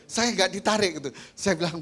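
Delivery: usual speaking voice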